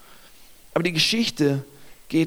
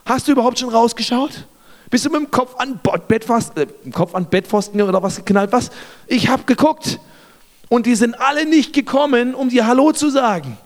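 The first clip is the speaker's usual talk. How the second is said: higher-pitched, exasperated disbelief; incredulous servant; 4 semitones higher than usual. Acted. Servant doubting Elisa has looked outside, exasperated disbelief. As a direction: challenge him as if he's missed the obvious. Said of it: "wahrscheinlich denkt der Diener in diesem Moment".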